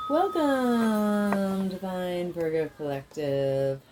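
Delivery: slow, drawn-out ceremonial welcome; ritual greeting. Acted. Healer welcoming the Virgo collective, slow and ceremonial. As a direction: open the reading like a ceremony.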